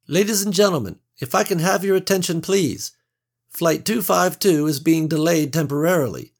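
high, bright cabin announcement voice; polished stewardess PA announcement; flight attendant. A narrator's reading. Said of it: "stewardess addresses passengers".

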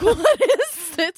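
high, drawn-out disbelief; retold amazement. Speaker reliving a chance meeting, slow high amazement. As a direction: stretch it out, baffled by the coincidence.

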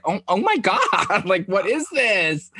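high, laughing disbelief; shocked clerk. Retold or imitated. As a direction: gasp it out, then laugh. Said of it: "And she was like".

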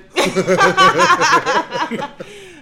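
big high burst of laughter; loud laugh. Real, not acted. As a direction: crack up loudly.

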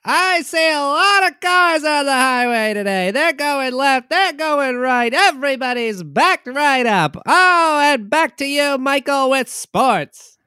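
high, loud helicopter traffic reporter. Pretend. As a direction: call traffic like a chopper reporter. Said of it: "Jimmy and the Chopper".

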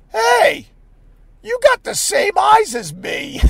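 very high, sing-out delivery; goofy high-voiced pickup line; silly chirpy quote. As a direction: pitch it way up, mock-friendly. Acting out his silly line.

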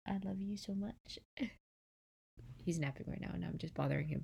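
soft hushed voice; whispering. Real, not acted. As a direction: keep it down, someone's sleeping. Whispering beside napping Hayes.

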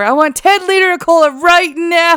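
high, loud, excited "You have that here?" rising; mock Farva. Retelling Farva ordering cola, loud high excited mock voice. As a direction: squeal it like a greedy, overexcited customer.

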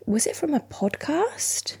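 soft slow whispered aside. Real, not acted. Quietly asking someone nearby about the story, slow whisper. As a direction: whisper the question so the mic barely catches it.

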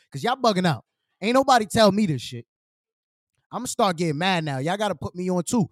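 ordinary talk, nothing marked

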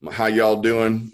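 a slow, light Southern drawl greeting; a hint of a Southern accent, stretched out slowly; mild Southern twang, drawn out. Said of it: "I had that Southern drawl".

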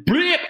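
sudden high squeaky blip; cartoon impact noise. Dildo slapping his face, squeaky cartoon blip. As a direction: make the smack sound high and sharp.

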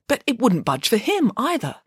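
usual speaking voice

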